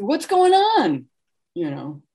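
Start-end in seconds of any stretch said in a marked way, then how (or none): 0.0-1.0 high, drawn-out barging-in neighbor; Lauren Bacall. Retold or imitated. Family friend barging into the kitchen, calling out high. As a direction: call it out slowly, pitched way up. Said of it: "just barge in and say".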